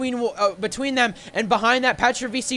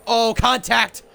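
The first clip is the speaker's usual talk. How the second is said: excited live race call; motorsport commentator; 5 semitones higher than usual. Real, not acted. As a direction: call the crash with rising energy.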